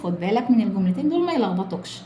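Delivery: ordinary delivery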